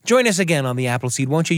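normal talking voice

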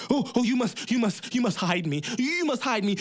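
panicked plea. Acted. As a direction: beg in panic. Giant begs in panic.

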